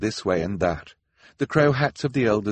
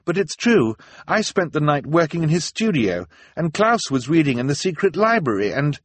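higher, louder, insistent explaining; earnest protest; 10 semitones higher, 5 dB louder than usual. A narrator's reading. Violet defends herself to an accusing crowd, earnest protest. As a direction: protest your innocence urgently.

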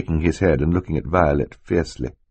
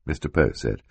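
usual speaking voice

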